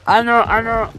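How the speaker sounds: high, loud dismay; dismayed cry. Real, not acted. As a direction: cry out in surprise. Something goes wrong.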